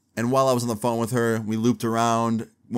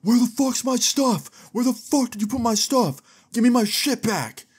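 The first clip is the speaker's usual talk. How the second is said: imitated angry yelling; 13 semitones higher than usual. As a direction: yell it like a furious man outside a door. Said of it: "he starts yelling".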